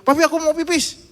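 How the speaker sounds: put-on a kid yelling for his dad; child calling out. Son calls papi from bed, high and loud.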